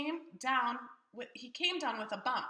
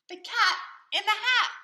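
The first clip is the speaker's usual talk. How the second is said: high, loud announcing of the title figure; grand reveal; 12 semitones higher, 9 dB louder than usual. A narrator's reading. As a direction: trumpet the cat's name like a big reveal. Dramatic reveal of the cat.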